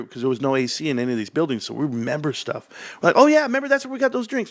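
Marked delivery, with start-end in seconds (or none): 3.2-4.5 put-on high, fast kid recall; excited kid voice. Kids to each other in the car, high fast excited voice. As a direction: blurt it out like a kid remembering.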